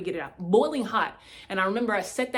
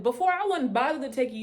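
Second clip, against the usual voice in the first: usual speaking voice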